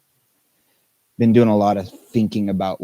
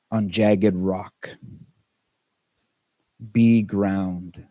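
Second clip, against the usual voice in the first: slow, measured verse with long pauses; unhurried recital of a poem; poem reading; much slower than usual. Acted. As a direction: read it line by line, letting each phrase sit. Reads a Rumi poem aloud.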